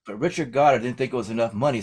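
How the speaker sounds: ordinary delivery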